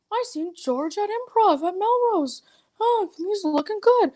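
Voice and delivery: slow, high put-on drawl; high voice, stretched words; Fraser Smith. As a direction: impersonate a gossiping name-dropper. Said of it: "I could do Fraser Smith".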